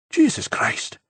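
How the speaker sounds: a startled gasp of Jesus Christ, voiceless; sudden breathy exclamation. A narrator's reading. Enzo startling the others in the cell with a sudden exclamation. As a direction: blurt it out in a rush of breath, caught off guard. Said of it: "he said suddenly".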